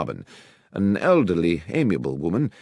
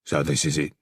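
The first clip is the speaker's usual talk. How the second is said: gruff voice; 5 semitones lower, 3 dB quieter than usual. Acted. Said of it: "a gruff voice said".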